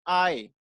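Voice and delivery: "आई" with no consonant at the start; pronunciation of "eye". Shown as a demonstration. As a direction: say "आई" as a long open "aa" gliding to "ee". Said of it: "इसका pronunciation होता है".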